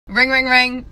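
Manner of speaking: loud spoken telephone ring; punchy mock ringtone; imitated phone ring. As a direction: mimic a ringing phone, loudly. Imitates store phone ringing.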